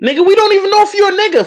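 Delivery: fast, high teasing; excited jab. Real, not acted. Host ribbing the caller, fast teasing. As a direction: rattle off the jab.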